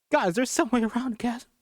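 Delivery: high, pleading cry; desperate plea. Acted. Ash desperately asking about escape, high pleading cry. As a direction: beg for a way out.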